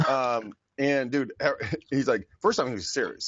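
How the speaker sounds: ordinary talk, nothing marked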